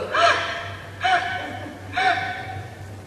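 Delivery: high, imitated baby gasps; baby's gasp. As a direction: gasp in little bursts like a baby. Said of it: "and goes".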